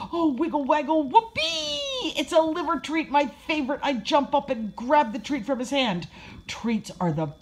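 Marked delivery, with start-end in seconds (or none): 0.1-6.1 high-pitched puppy glee, peaking on whoopee; overjoyed. A narrator's reading. Puppy sees a liver treat, overjoyed. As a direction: burst with delight, voice leaping up.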